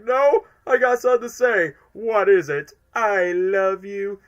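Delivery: high, loud, drawn-out goodbye; mock tearful farewell. Fan acting out Ivo's goodbye, hammed-up and tearful. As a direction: ham up the dying farewell.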